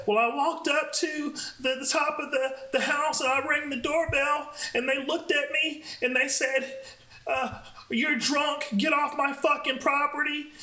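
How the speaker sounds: imitating the friend, high and whiny; lightly mock the drunk friend; high drunk girl voice.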